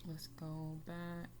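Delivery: ordinary talk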